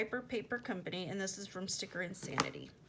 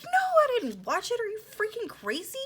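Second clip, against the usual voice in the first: high, loud, incredulous outburst; indignant retort; 13 semitones higher, 9 dB louder than usual. Retold or imitated. Snapping back at him over watching the snake, indignant. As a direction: fire back in a much higher, louder voice.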